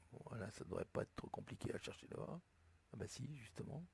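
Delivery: muttered aside. Real, not acted. Instructor mutters to himself while scrolling for the letter, muttering softly. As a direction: grumble it under your breath, almost unvoiced.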